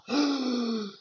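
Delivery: high, hammed-up telenovela gasp; dramatic soap gasp. Acting a telenovela twist, high dramatic gasp. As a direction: gasp like a shocked soap heroine. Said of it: "like watching one of those telenovela".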